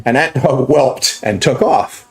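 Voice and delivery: ordinary talk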